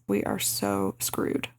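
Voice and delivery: low, slow mock mutter; imitated aside, dropped low and drawn out; under her breath. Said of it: "under her breath".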